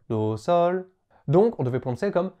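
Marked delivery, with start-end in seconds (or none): 0.1-0.9 "Do, sol" stepping up in pitch from "Do" to "sol"; intervalle de quinte. Shown as a demonstration. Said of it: "l'intervalle entre un do et un sol". (« Do, sol » entonné en montant d'une quinte)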